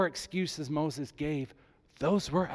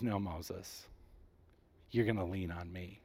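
lower, softer God voice, calm and firm; a lightly voiced God, steady and low; 8 semitones lower, 10 dB quieter than usual. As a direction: speak as God, low and quiet. Preacher voicing God's answer.

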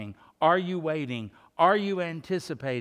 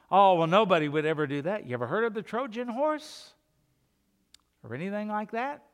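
ordinary talk

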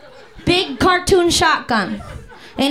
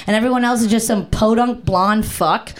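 normal talking voice

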